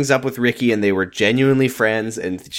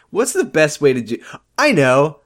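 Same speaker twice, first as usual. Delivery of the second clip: higher-pitched imitation of an eager bride; mock eager planner; 5 semitones higher than usual. Podcast host mimics Adrian planning her wedding, mock eager. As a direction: act out her bright idea, lightly mocking.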